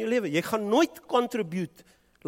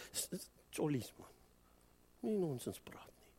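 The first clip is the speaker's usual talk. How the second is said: a hushed aside to the congregation; whispered aside; 13 dB quieter than usual. Real, not acted. Preacher murmurs a hushed aside to the congregation. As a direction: whisper it under your breath.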